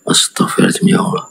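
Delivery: a soft, breathed astagfirullah under the breath; whispered prayer. Real, not acted. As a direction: pray it in a hush, shaken. Praying under breath in alarm. (doa berbisik, pelan dan cemas)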